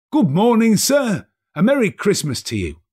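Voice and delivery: high, bright-hearted hail; cheerful greeting. Acted. Passers-by greeting Scrooge, high and hearty. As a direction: greet him like good-humoured fellows.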